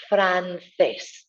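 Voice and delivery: francés with a clear th sound, fran-THES; Spanish c, tongue out. Shown as a demonstration. Teacher repeating francés after explaining the tongue. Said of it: "Tongue coming out".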